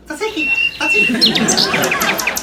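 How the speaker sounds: "Tzatziki" squeaked very high like a chirping bird; little bird. Shown as a demonstration. Host mimics a bird, squeaking "Tzatziki" very high. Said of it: "Sound like a little bird".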